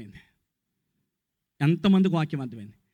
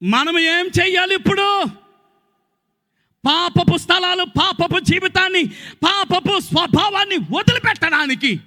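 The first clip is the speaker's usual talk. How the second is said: preaching at full force; 11 semitones higher than usual. Real, not acted. Preacher rousing the church, at full force. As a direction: preach it hard.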